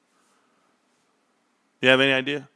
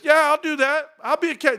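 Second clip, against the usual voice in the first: very high, over-keen voice; eager mock acceptance; 14 semitones higher than usual. Streamer acting out taking the cashier job, over-eager. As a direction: jump at the offer in a high voice. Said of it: "I was like this".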